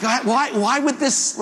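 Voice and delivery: high-pitched, repeated pleading; mock bewilderment. Patient questions his bad news, high mock dismay.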